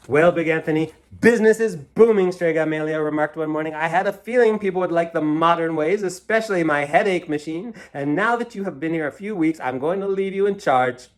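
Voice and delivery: lightly voiced proud rival witch; boastful rival. A narrator's reading. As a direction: crow about her success. Strega Amelia boasting.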